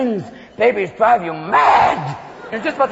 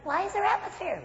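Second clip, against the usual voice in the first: put-on high, soft toddler question; curious small child; 9 semitones higher, 11 dB quieter than usual. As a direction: ask in a tiny, high child's voice. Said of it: "He looks up".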